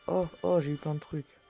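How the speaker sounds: excited. Real, not acted. Excited at loot.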